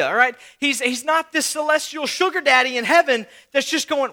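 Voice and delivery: normal talking voice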